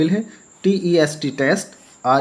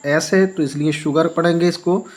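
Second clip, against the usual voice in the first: usual speaking voice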